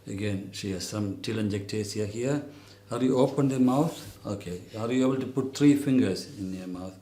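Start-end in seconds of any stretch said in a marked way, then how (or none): none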